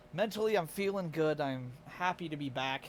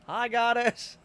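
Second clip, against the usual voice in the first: excited greeting; 7 semitones higher, 8 dB louder, slower than usual. Real, not acted.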